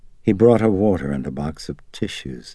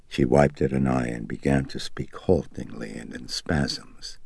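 usual speaking voice

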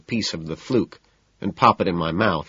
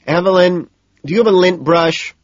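loud, high call across the room; 12 semitones higher, 8 dB louder than usual. A narrator's reading. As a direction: call out to Evelyn. Price calling across room.

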